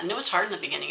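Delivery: normal talking voice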